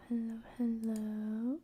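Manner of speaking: "hello, hello" breathed out in a slow whisper with a lift at the end; whispered greeting. Acted. Streamer greeting viewers, slow whispered hello. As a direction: whisper "hello" slowly, as if leaning in close.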